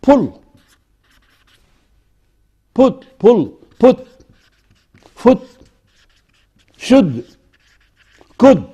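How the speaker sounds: "Pull", "Put", "foot", "should", "could" with short rounded u; short OO vowel. Shown as a demonstration. Teacher contrasting the other oo, short u in "Pull", "Put", "foot". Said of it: "What about the oo?".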